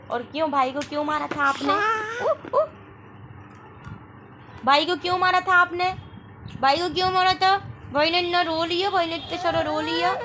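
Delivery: high, loud scolding. Real, not acted. Mother scolds her daughter again for hitting her brother. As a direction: make her feel she hurt her brother.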